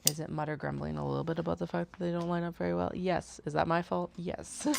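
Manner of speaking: usual speaking voice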